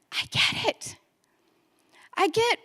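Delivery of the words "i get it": whispered, hushed awe; awed whisper. Real, not acted. Speaker quietly taking in the realization, whispered awe. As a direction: breathe it out in wonder.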